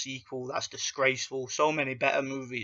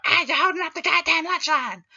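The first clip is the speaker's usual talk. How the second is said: much higher, louder mock cartoon yell; cartoon kid yelling; 8 dB louder than usual. Mocking South Park swearing humour, hammed-up kid yell. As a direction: scream it like a bratty cartoon kid. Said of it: "terrible South Park episodes".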